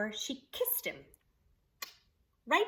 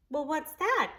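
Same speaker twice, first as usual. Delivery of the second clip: surprised; 7 semitones higher, 6 dB louder than usual. Acted. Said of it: "looked surprised".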